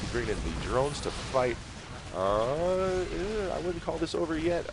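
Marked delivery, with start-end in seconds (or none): 2.1-4.7 excited. Real, not acted. Caster reacts to comeback.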